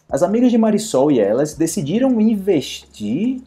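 ordinary delivery

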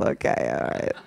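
very soft, near-whispered reassurance; hushed consoling. Acted. Comic soothes the person just roasted, hushed and consoling. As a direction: console them under your breath.

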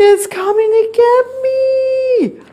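gasp, then very high wail; terrified squeal. A narrator's reading. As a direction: squeal in storybook terror. Stanley frozen with fear.

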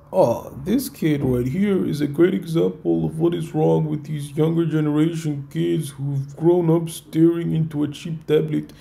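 mocking read of the comment; sarcastic reading. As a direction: read it in a put-on sneering voice. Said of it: "He says".

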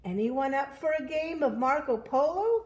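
eager Pig, high and loud; calling out. Acted. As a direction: call to the whole crowd. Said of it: "called Pig".

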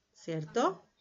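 ordinary delivery

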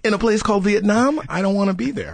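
put-on high, loud alarmed child's voice; alarmed kid. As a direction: squeal it out like a frightened kid. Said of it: "immediately I'm like".

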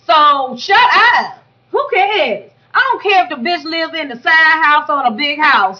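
loud, high, furious rant; heated rant. Real, not acted. Fed up with the Karen tax gossip, shouting angrily. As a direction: unload on the haters, full volume.

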